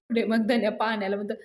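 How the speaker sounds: ordinary delivery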